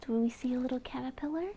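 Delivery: quick secretive whisper. Acted. Asking a toddler to find the caterpillar, whisper. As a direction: hush as if the caterpillar might hear.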